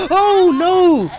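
high, loud cry of alarm; startled cry. Real, not acted. Player caught by a child crying, startled shout. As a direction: yelp in sudden dread. Sudden scare in the game.

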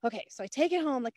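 usual speaking voice

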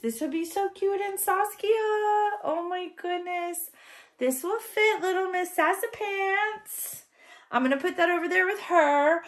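high-pitched cooing excitement; delighted squeal. Real, not acted. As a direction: gush over how cute it is. Delighted at doll outfits.

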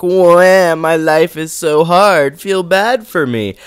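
high, loud, drawn-out whiny mock cry; mock whining. Mocking a self-pitying whiner to viewers, high whiny voice. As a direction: whine it out slowly, high-pitched, hammed-up. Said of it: "like, the little whiny bitch".

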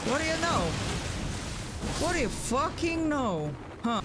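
high sing-song mockery; mocking taunt. Real, not acted. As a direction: rub it in, high. Mocking the boss.

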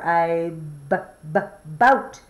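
"b-b-boat" with the b tapped three times before the word; stretched-out b sound. Shown as a demonstration. Teacher to child, isolating the first sound, playful sounding out. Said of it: "Do you hear A or I in b-b-boat?".

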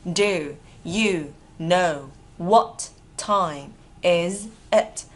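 very slow, separated words; drawn-out, deliberate teaching model. Shown as a demonstration. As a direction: dictate it to learners with pauses. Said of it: "Đọc nối ha".

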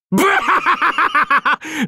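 high, explosive burst of laughter. Acted. Cracking up at the magical girl idea, explosive guffaw. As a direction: burst out in a long mocking belly laugh.

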